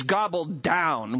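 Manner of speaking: "gobbled down" drawn out slowly; emphasized phrase. Shown as a demonstration. Preacher giving the literal Greek, slow and emphatic. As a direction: linger on "gobbled down". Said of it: "The Greek literally reads".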